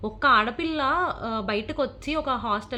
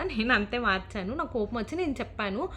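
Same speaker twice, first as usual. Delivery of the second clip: ordinary talk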